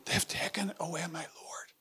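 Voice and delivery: broken, whispered grief; grieving whisper. Retold or imitated. Mary whispering her grief to Peter, broken. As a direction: whisper it as if the loss just hit. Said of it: "hear the grief in her tone".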